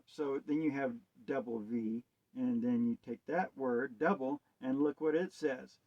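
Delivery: ordinary talk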